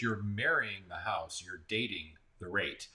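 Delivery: slow, deliberate slogan; slow and careful. Real, not acted. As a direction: spell it out slowly, word by word. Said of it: "You have to say that slowly".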